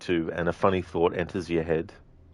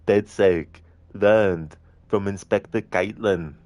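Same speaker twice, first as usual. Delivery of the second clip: slow, louder Arnold Schwarzenegger voice; slow, heavy Arnie impression; 5 dB louder, slower than usual. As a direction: do Arnold Schwarzenegger reading the briefing. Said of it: "for redeeming the Arnie impression".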